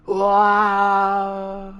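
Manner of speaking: high, loud, sustained wail; falling scream. Acted. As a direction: scream as you plummet away. Captive dropped in acted movie scene.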